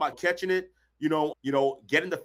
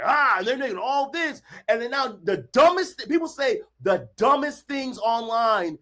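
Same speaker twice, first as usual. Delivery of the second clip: ordinary talk, nothing marked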